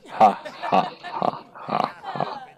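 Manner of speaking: soft villain cackle fading to a breathless hush; mock evil laugh trailing off. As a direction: cackle like a cartoon villain, dying away. Villain laugh after ominous line.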